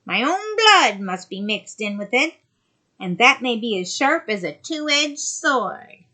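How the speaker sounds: loom over her as the sea witch. Acted. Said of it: "said the witch".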